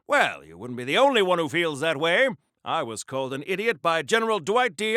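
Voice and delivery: ordinary talk, nothing marked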